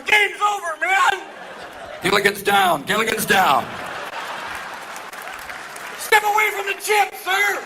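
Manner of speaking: high, loud mock alarm; frantic bodyguard yelling; panicking Secret Service agent. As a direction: shout an emergency in a hammed-up panic. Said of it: "Secret Service are going".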